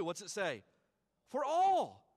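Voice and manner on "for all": loud call. Acted. Answers own question, calling out.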